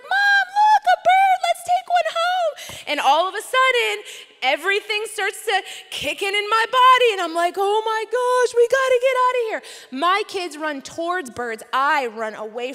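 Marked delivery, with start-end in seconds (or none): 0.0-2.5 put-on very high, eager toddler voice; very high excited toddler asking to keep a bird; little kid voice. Voicing her two-year-old. 7.6-9.6 mock panic. Panic at the geese with kids.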